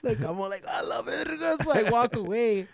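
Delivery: high, loud delighted gush; gleeful. Retold or imitated. Still gloating after sneaking in, delighted high gush. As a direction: savor the win.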